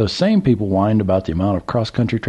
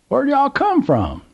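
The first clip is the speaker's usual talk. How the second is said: put-on high, excited drawl of an old country man; old Southern gentleman; slower than usual. A narrator's reading. Old man calls to trucker, high Southern voice. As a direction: play the curious old man at the gas pump.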